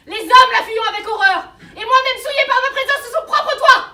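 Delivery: building from loud to roaring indignation; rising outrage. Acted. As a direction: thunder it out like a scandalised bigot. Outraged lecture to a servant girl. (indignation qui monte jusqu'au cri)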